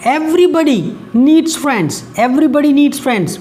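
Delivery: slow, careful dictation of the example sentence; slow read-aloud. Shown as a demonstration. Teacher reading the example sentence slowly to students. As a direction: read it out word by word for the class.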